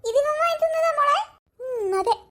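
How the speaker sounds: choked up, pitch rising; moved to tears by a son's gift; mother's voice catching with emotion; tearful. Acted.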